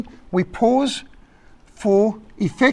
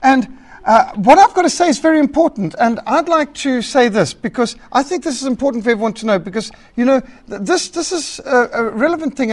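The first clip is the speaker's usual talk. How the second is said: higher, louder, self-important caller droning on; rambling radio caller; 4 semitones higher, 5 dB louder than usual. Retold or imitated. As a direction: play the caller who won't get to the point. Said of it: "radio phone show".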